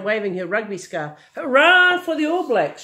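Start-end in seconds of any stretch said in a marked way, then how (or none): 1.3-2.8 louder, higher hurrah; granny cheering for the All Blacks; cheering cry. A narrator's reading. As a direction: cheer the team on from the sideline. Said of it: "she cried".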